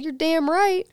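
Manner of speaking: high, hard mock snap; defiant retort. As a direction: throw it back at her.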